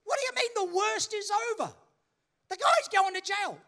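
high-pitched acted outrage; incredulous protest. Friend challenges lawyer about the husband going to jail, incredulous. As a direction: throw it back in loud disbelief. Storyteller acts disbelief at lawyer.

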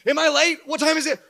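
panicked at waking in the night; mock alarm. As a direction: jolt awake afraid you overslept. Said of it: "wake up in the middle of the night like".

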